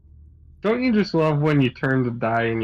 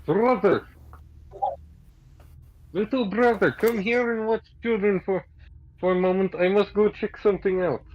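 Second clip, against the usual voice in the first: higher, stilted warrior voice; earnest, halting fighter giving orders; Greg in character; 7 semitones higher than usual. Acted. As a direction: call over a little brother formally. Player voices Greg calling his brother.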